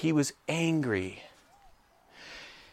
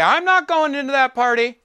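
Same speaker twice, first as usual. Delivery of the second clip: much higher and louder, a stamping tantrum; hissy fit; 13 semitones higher, 8 dB louder than usual. Acted. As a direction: throw a childish hissy fit. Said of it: "He's gonna have a hissy fit".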